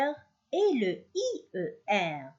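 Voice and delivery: usual speaking voice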